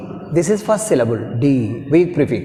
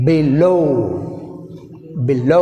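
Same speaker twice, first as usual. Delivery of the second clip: "Below" with a strong, long "లో"; stress on second syllable. Shown as a demonstration. Teacher says pronounce it firmly, second syllable stressed. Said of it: "గట్టిగా పలకండి".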